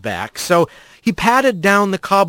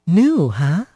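slender rat woman. A narrator's reading. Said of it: "said the slender rat".

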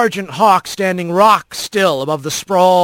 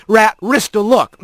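ordinary delivery, nothing marked

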